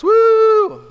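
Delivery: high, loud mock whoop; a hammed-up cheer for a dry topic. Cheering at data infrastructure.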